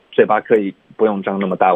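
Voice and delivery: usual speaking voice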